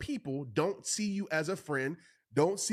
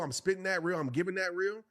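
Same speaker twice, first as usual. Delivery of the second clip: ordinary talk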